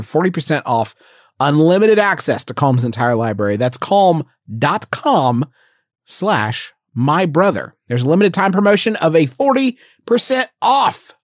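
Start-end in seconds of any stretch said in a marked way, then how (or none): 3.6-7.7 slow, spelled-out read; slow deliberate read. Shown as a demonstration. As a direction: spell the address out for someone who can't spell it.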